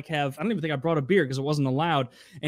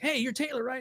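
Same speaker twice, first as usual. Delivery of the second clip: higher, soft eager greeting; imitating Andy; 9 semitones higher, 6 dB quieter than usual. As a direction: play the overly friendly new coworker. Coworker Andy greets narrator.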